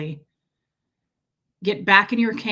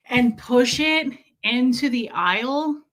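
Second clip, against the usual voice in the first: ordinary talk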